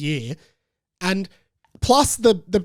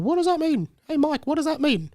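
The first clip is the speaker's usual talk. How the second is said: higher mock questioning voice; imitating a listener; 9 semitones higher than usual. Host voicing his listener, higher and curious. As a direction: ask it like a curious fan. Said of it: "That was me pretending to be you there".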